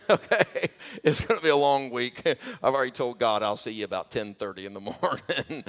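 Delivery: chuckling through the confession; sheepish real laughter running through the words; laughing. Real, not acted. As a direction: laugh at yourself while admitting it.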